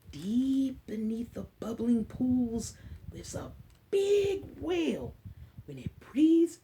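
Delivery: slow, hushed read-aloud; poem reading. Acted. Teacher reading the whale poem slowly to the class.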